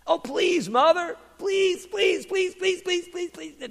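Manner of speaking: high-pitched rapid-fire begging; pleading child. Acted. Young donkey begs his mother to let him go, pleading. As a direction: beg eagerly, running the pleases together.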